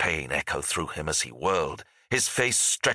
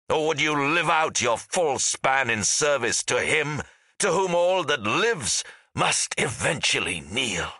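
high, ringing challenge with a harsh edge; hollow, harsh; 7 semitones higher than usual. A narrator's reading. Angry ghost challenging the fort's defenders, hollow and harsh. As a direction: demand submission in a hollow, harsh voice. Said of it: "hollow and harsh".

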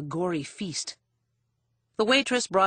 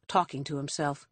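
ordinary delivery, nothing marked

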